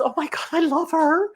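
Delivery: very high, mock-adoring squeal without extra volume; high hammed-up swoon; gushing. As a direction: act out a smitten fan, pitch way up. Acting out converted viewer's gushing.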